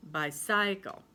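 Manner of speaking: "bicycle" as bye-SIGH-kul; stress on middle syllable. Shown as a demonstration. Teacher repeating a wrong stress, "bicycle" stressed on the middle "cy". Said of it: "pronounce it with the C Y".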